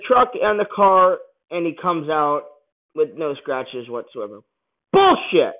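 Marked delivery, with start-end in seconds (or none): 4.9-5.5 high, loud outraged yell; outraged shout. Real, not acted. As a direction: blurt it out in disbelief at the screen. Ranting at implausible stunt.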